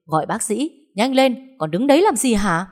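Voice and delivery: loud, high panicked order; scared yell. Acted. Hoa yelling at her sister-in-law to fetch a doctor, loud panic. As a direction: scream for the doctor, frantic. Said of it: "cô sợ hãi hét lên".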